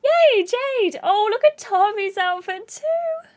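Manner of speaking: high-pitched squeal of delight; hammed-up girly excitement; excited cheering. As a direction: cheer a friend on in a high, giddy voice. Excited islanders cheering Jade on.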